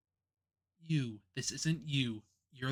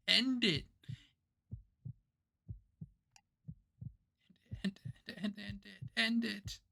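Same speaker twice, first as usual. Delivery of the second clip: breaking plea; 8 semitones higher than usual. Acted. Karma breaking down, begging.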